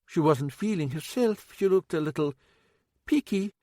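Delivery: fussing, a wee bit worried cook; older woman, worried. A narrator's reading.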